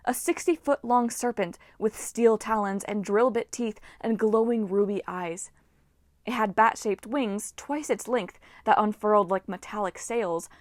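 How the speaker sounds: usual speaking voice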